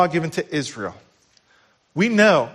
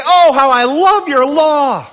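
fervent cry; 10 semitones higher, 8 dB louder, slower than usual. Acted. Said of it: "That's why David could say".

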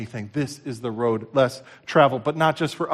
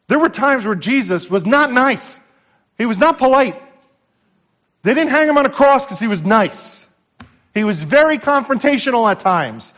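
impassioned preaching, raised and high; 8 semitones higher, 6 dB louder than usual. Acted. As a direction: preach it with full force. Preacher builds to full force.

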